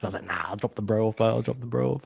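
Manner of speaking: low, soft resolve; quiet. Retold or imitated. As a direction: murmur it to yourself. Retold decision, much quieter.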